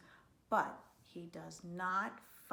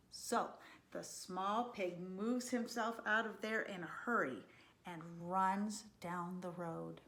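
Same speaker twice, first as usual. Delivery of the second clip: ordinary delivery, nothing marked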